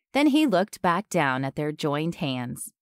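ordinary delivery

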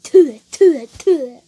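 loud, sharp "tuh" spit noises; imitated spitting. Criticising bad French by imitating loud spits. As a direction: mimic spitting, one burst per "tuh". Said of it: "That's like".

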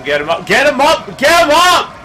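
repeated command climbing to a high, loud shout; urgent, building to a yell. Real, not acted. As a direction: urge harder each time until yelling. Urges revival, building to shout.

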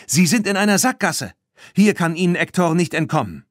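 high, loud call; calling to the others. A narrator's reading. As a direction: call to friends riding behind her. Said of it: "rief Claire den anderen zu".

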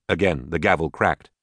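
ordinary delivery

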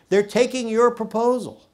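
high, loud, eager news; excited. Retold or imitated. Embassy secretary phoning with good news, excited and high. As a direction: blurt out the good news, thrilled. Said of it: "called me and said excitedly".

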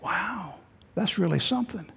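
breathless whispered wow; hushed gasp of wonder at the stars; awed whisper. Retold or imitated. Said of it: "and I was saying".

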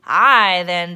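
very loud pirate bellow. A narrator's reading. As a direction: bellow it like a captain. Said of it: "bellowed Braidbeard".

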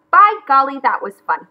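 loud burst that settles quickly; exclaiming. A narrator's reading. McGreely pleased with his shell hunt, exclaiming. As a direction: exclaim it with gusto. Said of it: "he exclaimed".

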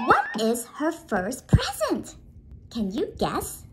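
ordinary talk

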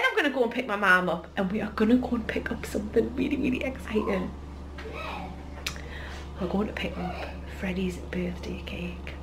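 hushed whisper; secretive whisper. Real, not acted. Secret surprise for child.

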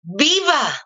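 a lightly voiced mysterious stranger; mock strange lady. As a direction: play the odd lady cheering right in her face. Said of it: "y me dice".

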